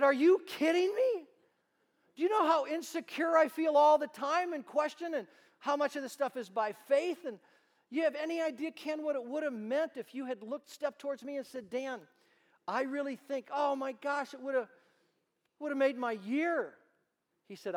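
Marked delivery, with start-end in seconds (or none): 0.0-7.2 much higher and louder, disbelieving; incredulous outburst. Retold or imitated. Telling old mentor Ken how insecure he felt, high and loud. 7.2-16.7 high, earnest and pressing; pleading. Retold or imitated. Pleading with Ken about what his words would have meant, high and urgent.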